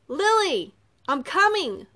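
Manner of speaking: loud, high rescuer's call; crying out. A narrator's reading. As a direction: shout urgently from a distance. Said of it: "a voice from behind them cried".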